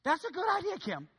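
high, bright mock praise; teasing the congregation member with high praise; mock approval. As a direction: say it like a delighted onlooker. Preacher mimics enthusiastic approval.

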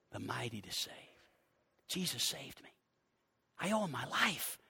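suddenly low and soft; hushed testimony. Real, not acted. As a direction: drop to a quiet, personal confession. Hushed personal testimony after hymn.

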